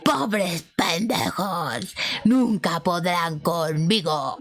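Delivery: slow whispered menace; eerie whispered threat; demon whisper. Retold or imitated. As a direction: hiss it like a demon. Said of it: "y les dice".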